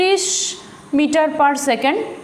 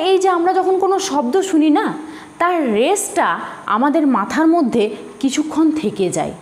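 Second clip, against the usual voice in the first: usual speaking voice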